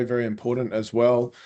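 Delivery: ordinary delivery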